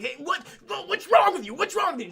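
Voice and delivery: high, loud, stumbling imitation; hammed-up urgent demand; mock agitated yelling. Arnold confronting the panicked woman, mock agitated yell.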